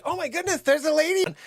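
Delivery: high-pitched imitation of a nurse; mock alarmed nurse. Nurse spots the fallen lady, mock high alarm. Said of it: "one of the nurses is like".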